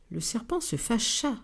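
ordinary delivery

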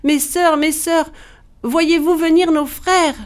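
high-pitched, urgent call; crying out. A narrator's reading. Wife crying toward the ramparts for her sisters, urgent high cry. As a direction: call desperately to someone far up above. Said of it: "elle criait en direction des rempart".

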